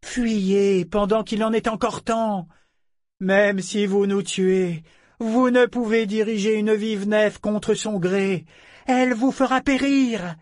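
commanding tone. Acted. Ambre warning the boarders they cannot hold the ship, commanding. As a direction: speak with calm authority over the wind. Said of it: "son ton en imposait singulièrement".

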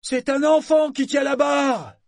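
very high, loud astonished shout. Acted. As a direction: yell it across the water. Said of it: "cria Sorkor".

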